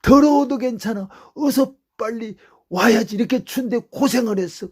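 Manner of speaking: loud, high scolding mother's voice. Retold or imitated. Mother scolding her son for coming home late, loud and high. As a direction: scold him like a worried mother.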